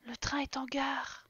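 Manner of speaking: slow, hushed station announcement; slow whispered train announcement. Pretend. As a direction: whisper it like a mock station call.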